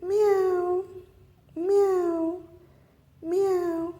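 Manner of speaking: high mewing cat sound; playful kitty impression; cat meow. Shown as a demonstration. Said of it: "It goes".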